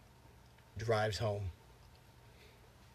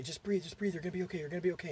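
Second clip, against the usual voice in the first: high-pitched, urgent retold soothing; mock panicked reassurance; 6 semitones higher, faster than usual. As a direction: play a brother trying to calm a choking sister. Panicked brother reassures choking sister.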